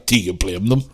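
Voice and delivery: ordinary talk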